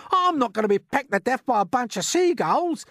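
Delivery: high, loud, protesting earthworm; indignant cry. A narrator's reading. Earthworm refusing to be pecked, crying out indignantly. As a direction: protest loudly against the horrible fate. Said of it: "cried the earthworm".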